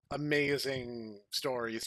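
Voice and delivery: ordinary delivery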